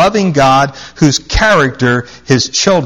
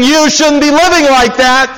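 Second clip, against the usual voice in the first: mock scolding; 12 semitones higher, 4 dB louder than usual. Mocks Christians shaking fist.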